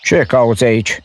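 loud, high giantess challenge; booming voice. A narrator's reading. Giant woman confronting the intruding boy loudly. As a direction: demand who dares enter. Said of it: "un glas tunător".

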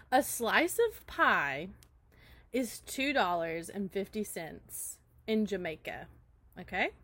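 slow, deliberate setup; slow joke setup. Acted. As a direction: draw out every word.

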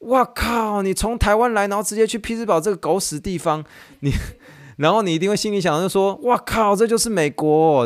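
high, mock-crude wife voice; hammed-up disbelief, swearing. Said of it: "马上回一句大脏话". (太太夸张地骂脏话)

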